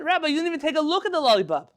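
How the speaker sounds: can't believe he didn't look; incredulous protest. Retold or imitated. Student protests the rabbi's answer.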